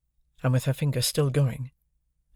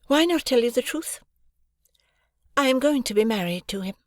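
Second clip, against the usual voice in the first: much higher, cool and blunt; Estella's voice; 11 semitones higher than usual. A narrator's reading. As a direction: state it flatly, unmoved.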